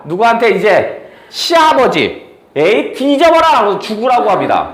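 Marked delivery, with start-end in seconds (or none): 2.5-3.5 mock curse. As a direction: hurl it at him like a spoiled princess. Princess cursing father-in-law.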